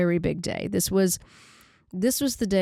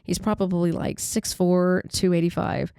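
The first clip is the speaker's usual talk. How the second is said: usual speaking voice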